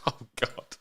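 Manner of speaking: ordinary talk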